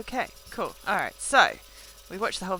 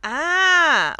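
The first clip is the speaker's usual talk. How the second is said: startled yelp; 9 semitones higher, 9 dB louder than usual. Real, not acted. Startled by the game.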